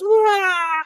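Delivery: mock baby wail, pitched very high; imitated baby cry. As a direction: wail like a toddler who just fell. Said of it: "and the baby goes".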